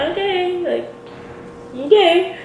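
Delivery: high, drawn-out, uneasy okay; awkward okay. Retold or imitated. He hopes to see her around, awkward high okay. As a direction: stretch it out high, unsure how to respond. Reacting to his awkward goodbye.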